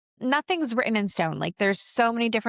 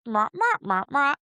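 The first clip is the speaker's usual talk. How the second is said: muffled wah-wah trombone mumble, pitched higher; Peanuts adult voice; 5 semitones higher than usual. Retold or imitated. Medium recalls spirit whispers as Peanuts adult mumbling. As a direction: imitate the garbled Peanuts grown-ups. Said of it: "like the Adults in the Peanuts cartoon".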